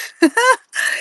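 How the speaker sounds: a quick high giggle; high laugh. Real, not acted. Joking with chat about Lavender's fake baby, high giggle. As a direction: laugh high and breathy at her own joke.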